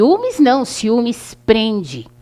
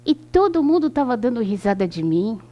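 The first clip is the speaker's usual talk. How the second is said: normal talking voice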